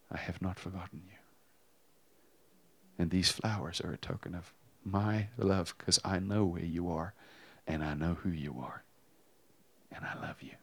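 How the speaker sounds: gently reassure, sinking to a near whisper; quiet, unhurried tenderness; tender reassurance. Retold or imitated. Voicing God's tender reassurance.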